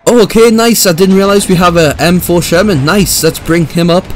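high, loud delighted surprise; thrilled to find the tank; excited. Real, not acted. Discovers a Sherman tank.

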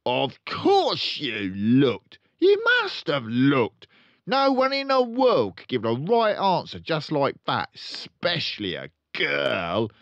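loud, high accusing father; furious dad accusing; angry father. Acted. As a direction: jab every word at her. Father ranting.

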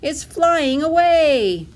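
high, loud, drawn-out wail; gasped alarm. A narrator's reading. Duckling watching her feather fly away, alarmed. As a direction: wail it out slowly, pitched high. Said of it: "gasped Dilly".

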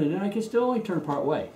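ordinary talk

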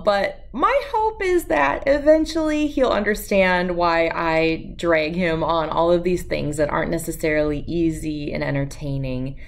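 ordinary delivery, nothing marked

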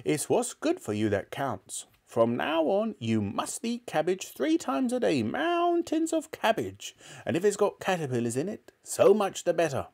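put-on high, relishing old-woman voice; bossy grandmother. A narrator's reading. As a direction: lay down the law as a nasty granny. Grandmother laying down rules.

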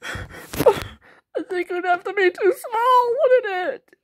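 put-on high, slow, tearful old woman; high old lady voice. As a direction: whimper it out like a frail granny. Grandma doll sees small apartment.

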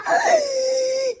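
high, delighted gasp; excited gasp. Acted. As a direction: gasp at the surprise present. Opening the surprise gift.

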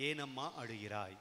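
soft, low, tender question; gentle questioning. Acted. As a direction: drop low and quiet to ask consolingly. Voicing Jesus' question to woman. (கனிவான, தாழ்ந்த குரலில் கேள்வி)

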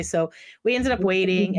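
usual speaking voice

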